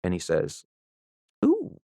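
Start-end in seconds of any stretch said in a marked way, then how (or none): 1.4-1.8 high, swooping put-on Southern voice; imitated Southern gent, high "ooh"; Southern accent. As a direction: do a rough Southern plantation-owner impression. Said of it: "I don't have a very good Southern accent".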